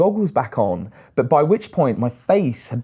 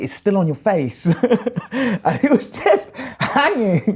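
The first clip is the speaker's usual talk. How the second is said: real laughter breaking through higher; recalling the tentacle on his face, laughing; 8 semitones higher than usual. Real, not acted. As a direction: giggle through the punchline. Laughing at the jellyfish story.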